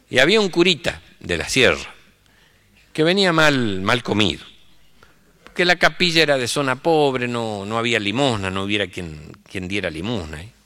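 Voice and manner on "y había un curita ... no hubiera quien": ordinary talk, nothing marked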